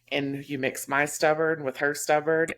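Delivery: usual speaking voice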